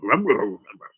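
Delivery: loud mock chomping noises; imitated dog munching. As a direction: chew noisily like a dog. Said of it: "And the dog goes".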